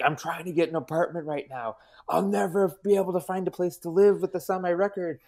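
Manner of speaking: high, rising panicky friend; panicked friend. Retold or imitated. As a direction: imitate a friend losing it, voice climbing. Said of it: "proceeded to lose his fucking mind".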